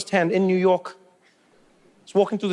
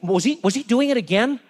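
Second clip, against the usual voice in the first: questioning American dad; 5 semitones higher than usual. Acted. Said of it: "he's like".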